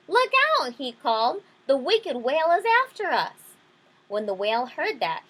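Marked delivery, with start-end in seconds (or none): 0.1-0.7 high, loud alarm call; panicked warning. Acted. Panicked fish sounds the alarm to the others, calling out. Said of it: "sounded the alarm". 1.7-3.5 loud, high-pitched warning cry; panicked alarm. Acted. Panicked fish warns the school about the whale, crying out. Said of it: "Panicked, the fish with the jagged fins sounded the alarm".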